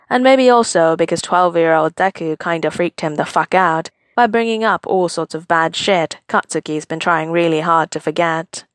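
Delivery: ordinary delivery, nothing marked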